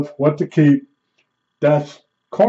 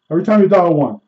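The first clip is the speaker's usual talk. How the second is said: ordinary talk, nothing marked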